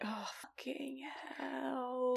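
breathy whispered oh fucking hell under her breath; a teacher tells a colleague, whispering a curse to herself. Retold or imitated. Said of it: "I'm like".

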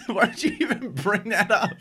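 high-pitched talk through laughter; squeaking words out between laughs; helpless laughing. Real, not acted. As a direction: protest while cracking up. Cracking up at partner's remark.

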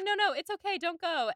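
lightly voiced Jane soothing him; sweet reassurance. Jane telling Bingley not to go, lightly voiced and gentle. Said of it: "And Jane is like".

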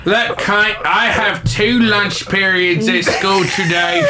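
high, slow Australian impression.

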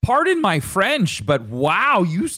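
high, slow, drawn-out wonder; playful amazement. Real, not acted. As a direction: marvel slowly at a friend's feat. Mock awe at him saying no.

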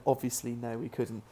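ordinary talk

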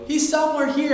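loud, high stage call; police officer's shout. Acted. Acting police officer's line on stage.

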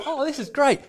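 high, put-on pleased voice; unchanged fake delight. Showing the face stays the same, fake delight. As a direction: keep the high gift-opening voice whatever the gift. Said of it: "it stays the same".

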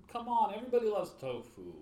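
loud and high, selling tofu with gusto; excited. Real, not acted. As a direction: cheer it like a tofu fan. Excited outburst about tofu.

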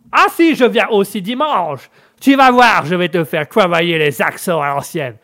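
brash American coach promising tough lessons; American accent. Acted. American coach threatening old-school accent drills, hammed-up American accent. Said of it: "des cours avec l'anglais et l'américain".

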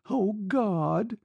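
put-on high, anguished girl's plea; broken. A narrator's reading. Girl clinging to her departing lover, high and broken. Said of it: "she whispered brokenly".

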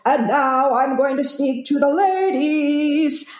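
slow, smooth imitation of a crooner's rich voice; mock rich baritone. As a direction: lightly put on a suave baritone for the ladies. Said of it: "in that just wonderful baritone, just that rich voice goes".

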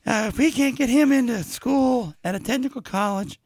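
high pleading mother voice; Shmi voice. Retold or imitated. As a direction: keep the high pleading mom voice. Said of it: "Shmi is like".

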